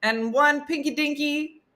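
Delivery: high, assured nonsense order; high mock-confident old man; confident grandpa. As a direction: order calmly like it's normal. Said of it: "he was like".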